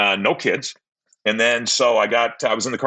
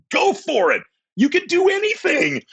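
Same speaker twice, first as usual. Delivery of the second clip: very high, ringing cheer; Doctor Phil pep talk. Retold or imitated. Encouraging a date to get back out there, high cheering voice. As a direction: hype them up like a TV therapist. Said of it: "I felt like Doctor Phil".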